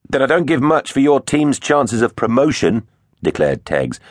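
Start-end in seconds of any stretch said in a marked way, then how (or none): 0.1-2.9 high-pitched cocky jibe; feigned cockiness. A narrator's reading. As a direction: act bolder than you feel. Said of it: "with a cockiness he didn't feel".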